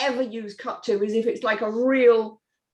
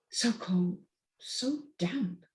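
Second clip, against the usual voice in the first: breathy whisper, voice dropping away; hushed whisper; 7 dB quieter, much slower than usual. Acted. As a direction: whisper it to yourself, cold and damp. Tailor shivering over cold fabrics.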